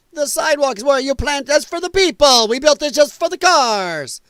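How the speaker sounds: loud, high, hammed-up Italian accent; bad Italian accent. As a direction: rant like a cartoon Italian driver in a terrible accent. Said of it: "Probably the worst accent ever".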